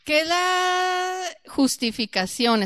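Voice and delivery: teacher prompting class, stretched; slow teacher question. Real, not acted. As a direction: draw the question out for students. Asking the class.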